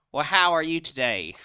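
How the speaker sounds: drive-thru lady. Retold or imitated. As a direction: belt out a sassy drive-thru greeting. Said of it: "a lady comes on".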